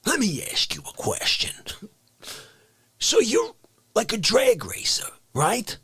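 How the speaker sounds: hushed whisper. Acted. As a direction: whisper it like a secret. Whispered bit.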